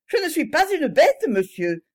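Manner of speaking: a high, loud indignant snap; furious retort. A narrator's reading. Young heiress rounding furiously on the notary. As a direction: bite back at the notary. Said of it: "d'un air furibond".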